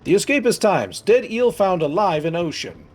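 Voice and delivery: loud, high news-crier voice; hammed-up newsboy shouting the headline; headline announcer. Mock newspaper headline read.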